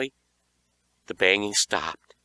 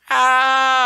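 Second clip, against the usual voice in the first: sudden high shriek of fright; high startled scream; terrified scream; 10 dB louder than usual. A narrator's reading. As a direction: shriek as the claw grabs you. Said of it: "I cried as a claw".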